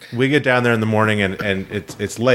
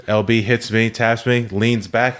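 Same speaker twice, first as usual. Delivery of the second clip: usual speaking voice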